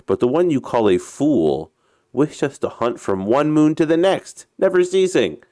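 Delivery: story chief's rising retort; chief arguing back. Acted.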